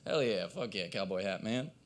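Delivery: normal talking voice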